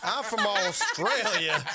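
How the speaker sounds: high, slow mock Australian with laughter; bad Australian accent. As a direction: drag out a hammed-up Australian accent. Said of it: "do an Australian accent".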